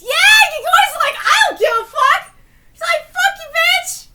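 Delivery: high, loud excited yelling; hyped shouting. Real, not acted. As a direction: rave at the screen.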